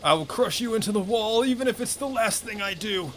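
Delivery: threatening villain. Acted. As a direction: shout it as an over-the-top vow of destruction. Voices villain's threat in game.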